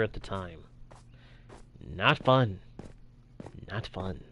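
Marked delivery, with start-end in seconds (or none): 3.7-4.2 much softer, half-swallowed repeat; muttered under breath. Real, not acted. Streamer repeating it to himself after the death, muttered. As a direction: mutter it to yourself.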